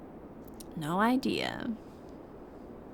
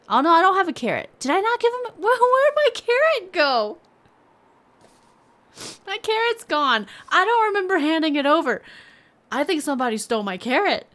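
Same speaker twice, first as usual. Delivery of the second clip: panicked; 9 semitones higher, 5 dB louder than usual. Real, not acted. Discovers the carrot is gone.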